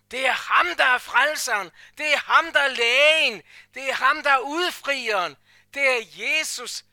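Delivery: preacher climaxing in a rousing chant; fiery preaching. Acted. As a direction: shout each line to lift the hall. Preaching at full force.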